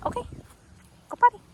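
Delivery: very high, loud cue; high sing-song go potty to the puppy. Real, not acted. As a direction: cheer her to go. Urging puppy to pee.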